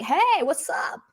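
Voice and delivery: casual greeting. Pretend. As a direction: play it as an offhand chat with a friend. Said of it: "walk up to somebody and be like".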